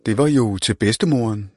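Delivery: plain reading voice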